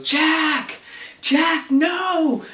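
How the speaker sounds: high, strained shout to stop; acting out a frantic scream; imitated desperate yell. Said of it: "And he's yelling".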